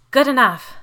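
relieved and emphatic. A narrator's reading. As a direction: let the relief fill it. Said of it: "her voice filled with relief".

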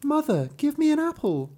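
put-on very high child's voice; squeaky-high storybook little girl; little daughter. A narrator's reading. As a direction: pipe up as a small girl asking mother. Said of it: "her little daughter went up too".

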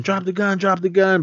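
high, urgent repeated command; mock shouted order. Guard yelling at robbers to drop the gun. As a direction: bark it like a panicked guard.

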